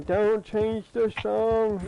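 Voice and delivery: high, drawn-out mock scream; imitated scream. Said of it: "I hear, like, some screaming".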